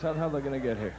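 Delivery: ordinary talk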